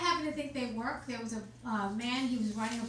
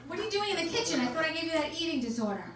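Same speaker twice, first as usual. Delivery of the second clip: mother scolding; 5 semitones higher, 4 dB louder, faster than usual. Retold or imitated. Mother scolding daughter, retold.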